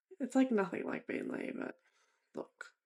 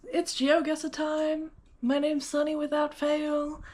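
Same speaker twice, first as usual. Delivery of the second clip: put-on American accent; 5 semitones higher, 4 dB louder than usual. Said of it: "I got an American accent".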